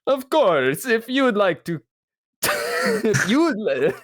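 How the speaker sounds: high, hammy vampire count with a rolling laugh; Sesame Street Count voice. Acted. Podcast host doing the Count to plug the show, hammy and high. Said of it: "have to go, like, Count Dracula, like, Sesame Street style".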